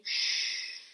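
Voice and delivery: quiet hushing shh; soft shush. Shown as a demonstration. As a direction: shush gently.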